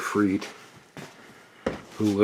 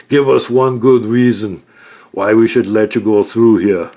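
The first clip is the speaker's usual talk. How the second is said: loud, higher, challenging; suspicious guard; 5 semitones higher, 9 dB louder than usual. Acted. Stair guard challenges Kaylor's story, loud and suspicious. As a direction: call out their lie.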